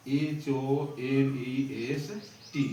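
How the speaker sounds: letters spelled out one at a time, slowly; letter-by-letter dictation; slow spelling. Shown as a demonstration. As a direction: spell it slowly for the class to copy. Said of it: "spelling out honest slowly".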